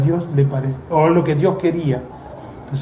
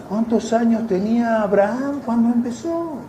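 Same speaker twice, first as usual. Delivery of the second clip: ordinary talk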